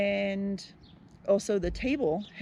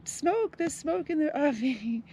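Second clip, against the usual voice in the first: high-pitched mock yell of alarm; imitating a yell; 6 semitones higher, slower than usual. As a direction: echo a kid's panicked shout, lightly. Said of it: "My daughter yelled".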